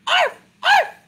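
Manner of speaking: high, sharp arfs; dog barking. Acted. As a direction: bark like a small dog. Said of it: "Dogs say".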